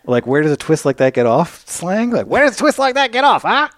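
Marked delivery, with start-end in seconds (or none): 2.3-3.7 high-pitched forties bad-guy gangster voice; hammed-up tough guy from a forties picture. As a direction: do an old-movie gangster, pushed way up high. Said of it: "forties bad guy gangster".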